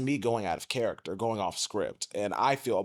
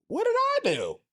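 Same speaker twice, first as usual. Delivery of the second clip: very high innocent question; mock innocent. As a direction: play wide-eyed innocence. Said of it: "It's like".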